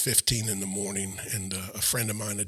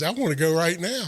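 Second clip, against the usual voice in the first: higher, louder, emphatic demand; retold insistence; 7 semitones higher, 5 dB louder than usual. Convert asks brother-in-law for immediate baptism, insistent. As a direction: quote yourself refusing to wait.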